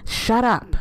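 interrupting. A narrator's reading. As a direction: cut the pleading off abruptly. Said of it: "the man interrupted".

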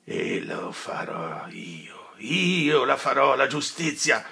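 slow hissed vow; cold whispered threat; vengeful whisper. Acted. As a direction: whisper the oath of revenge. Renzo swears vengeance.

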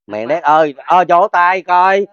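loud, high and very slow; excited cheer. Real, not acted. Healer urges viewers to clap, loud and elated. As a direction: drag it out to rally applause.

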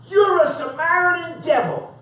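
loud, high-pitched hurled insult; dramatized scornful outburst from the pulpit; acted accusation. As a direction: hurl it like a hostile crowd. Voices crowd insulting Jesus.

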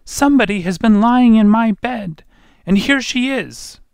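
piercingly high, loud tiny bear; shrill it out as a little wee bear. A narrator's reading. Wee bear finds the intruder in his bed, shrill wee voice. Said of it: "wee voice".

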